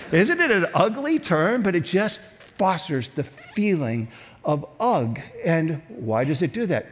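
ordinary talk, nothing marked

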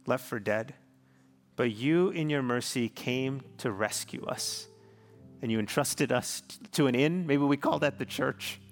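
ordinary delivery

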